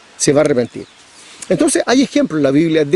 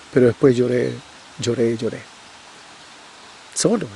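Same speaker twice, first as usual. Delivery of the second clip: fading grief; 5 semitones lower, 5 dB quieter than usual. Real, not acted. Pastor recalls crying alone.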